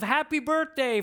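slow, high, sung-out happy birthday; a bright birthday shout from friends; joyful birthday cry. A narrator's reading. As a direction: cheer it out like a surprise crowd. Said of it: "The cries of".

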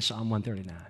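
ordinary talk, nothing marked

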